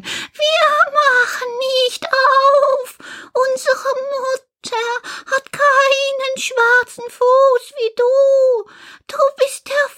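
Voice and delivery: put-on very high, slow little goat kids calling through the door; children's voices. Little goats shouting through the door at the wolf, very high childlike voices. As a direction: pipe it up high like frightened little kids refusing.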